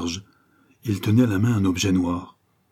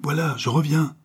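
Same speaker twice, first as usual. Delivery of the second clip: changed voice; 8 semitones higher than usual. A narrator's reading. Servant who senses a misfortune calls back, changed voice. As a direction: answer higher, as if shaken. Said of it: "d'une voix toute changée".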